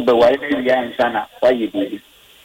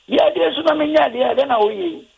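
high-pitched, quick mock complaint; acted protest; 12 semitones higher, faster than usual. Caller retelling a honeymoon quarrel, acted high-pitched protest. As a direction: blurt it high and fast, imitating a protest.

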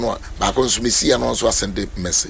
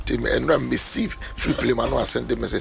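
ordinary delivery

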